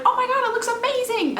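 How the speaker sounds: high-pitched, swooning gush; gushing delight. Retold or imitated. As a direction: gush like you just opened a dream package. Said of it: "I was like".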